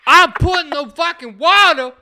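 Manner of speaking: angry yell. Retold or imitated. Reenacting the angry friend again.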